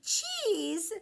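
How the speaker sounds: high, loud lamb cry; high little lamb crying out; lamb cries out. A narrator's reading. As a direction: call out as the lamb. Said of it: "cried Borreguita".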